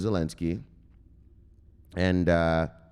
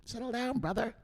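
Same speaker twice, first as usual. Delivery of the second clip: soothing Robbie; 10 dB quieter than usual. Retold or imitated. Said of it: "Robbie's like".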